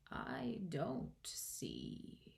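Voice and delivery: very slow, whispery drawl; sleepy, dismissive caterpillar, hushed; languid caterpillar. A narrator's reading. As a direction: breathe it out softly, word by word.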